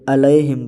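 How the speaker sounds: "علیہم" with a lax lae sound, a-lae-him; majhool vowel. Shown as a demonstration. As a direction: open the middle of "علیہم" to lae. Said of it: "لئے پڑھنا مجہول ہے". ("علیہم" مجہول لئے کے ساتھ)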